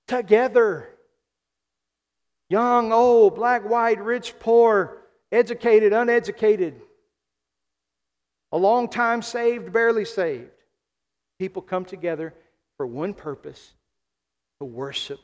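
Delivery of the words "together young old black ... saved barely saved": high, loud preacher's list; preaching. Real, not acted. Preacher to congregation on who worships together, raised preaching voice. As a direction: ring out each contrast, raised and forceful.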